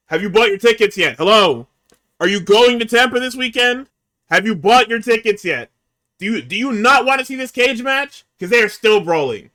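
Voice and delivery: loud, high pushy ad voice hawking tickets; mock hype pitch. Host hawking tickets to listeners in a mock loud hype voice. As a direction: sell the cage match like a pushy promo.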